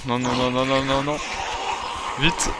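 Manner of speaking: ordinary talk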